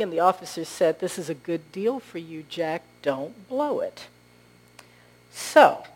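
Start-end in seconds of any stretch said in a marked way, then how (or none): 1.0-4.0 quiet warning. Acted. Officer quietly cautions old Jack.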